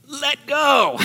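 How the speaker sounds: high, strained mock protest; comic strained plea. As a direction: squeeze it out as if gripped too tightly. Comic version of stop clinging.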